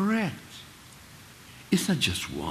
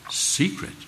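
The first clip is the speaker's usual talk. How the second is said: higher, breathy-free disbelieving echo of the word; incredulous scoff; 9 semitones higher than usual. Real, not acted. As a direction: toss the word back in disbelief. Scoffing at a claimed secret.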